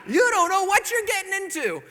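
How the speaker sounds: high, loud, crowing; loud gleeful taunt. Retold or imitated. Married man warning his engaged friend, gleeful taunt. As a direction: crow it as if through laughter.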